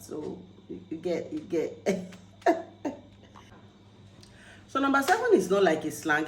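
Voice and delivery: usual speaking voice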